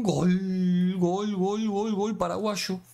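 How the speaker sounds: high, excited goal call; goal celebration. Real, not acted. Gamer cheering a goal in the penalty shootout, excited.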